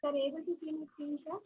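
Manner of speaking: very faint, far from the mic; low, faint voice over a call; barely audible. Real, not acted. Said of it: "But voice is little bit low".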